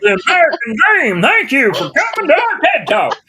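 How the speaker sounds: loud, high, hammed-up proclamation; big, booming send-up of an inspirational talk; mock TED Talk speaker. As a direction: parody a grand speaker signing off, high and loud. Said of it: "that voice".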